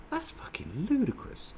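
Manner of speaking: high, slow, disbelieving scoff; high-pitched incredulous scoff at the absurdity; incredulous outburst. Retold or imitated. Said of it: "you go".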